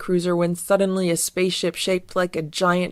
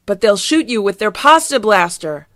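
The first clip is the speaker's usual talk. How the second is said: worried warning; 3 semitones higher, 6 dB louder than usual. Acted. Fieldgar frantically warns Garfield.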